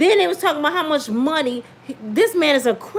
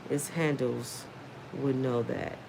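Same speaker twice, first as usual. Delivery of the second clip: ordinary talk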